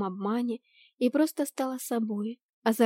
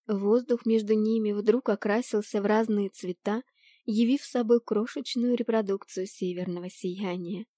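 usual speaking voice

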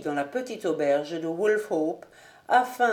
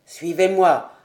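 louder, urgent command; violently agitated; 2 semitones lower, 6 dB louder than usual. A narrator's reading. Colonel pulling the stranger by his cloak, agitated. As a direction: yank him aside with the words. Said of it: "d'un ton violemment agité".

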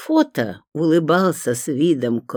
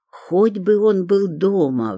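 high, drawn-out wishful plea; silent pleading; 7 semitones higher, slower than usual. A narrator's reading. Caller dialling the cherished number, pleading inwardly. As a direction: pray slowly that it comes true. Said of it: "взмолилась про себя я".

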